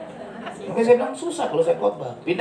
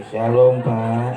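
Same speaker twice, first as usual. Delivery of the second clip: louder, low, drawn-out mimicked greeting; imitated greeting; 7 semitones lower, 4 dB louder, much slower than usual. Wife greeting the pastor back, in a louder imitated greeting. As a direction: imitate a greeting, stretching "Pak". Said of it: "Dia langsung begini".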